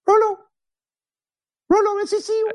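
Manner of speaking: put-on high old German auntie; high fussy old aunt. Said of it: "Hilda von Klackenschwab".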